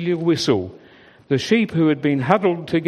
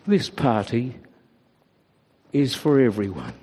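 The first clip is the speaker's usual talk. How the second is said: gently; 5 semitones lower than usual. A narrator's reading. Shepherd gently comforting the two sheep. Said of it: "he said gently".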